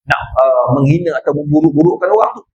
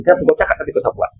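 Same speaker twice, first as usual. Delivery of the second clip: normal talking voice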